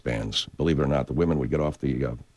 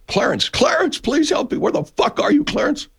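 Jimmy Stewart; 3 dB louder than usual. Retold or imitated. A pleading George Bailey calling for his angel, much higher. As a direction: beg for your guardian angel, high and desperate. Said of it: "I'm Jimmy Stewart".

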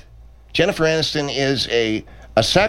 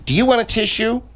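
mock concern; 12 semitones higher than usual. Director mocks the nose picker.

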